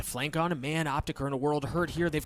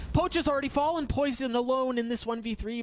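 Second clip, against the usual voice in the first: excited caster; 11 semitones higher than usual. Acted. Clutch moment in live cast.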